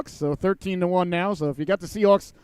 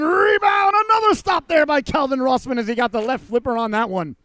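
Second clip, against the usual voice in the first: rising shout of a big save; play-by-play announcer erupting on a save; excited call; 7 semitones higher, 4 dB louder than usual. Real, not acted. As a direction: yell it as the goalie robs him.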